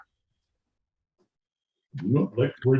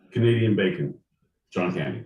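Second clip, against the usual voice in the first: ordinary delivery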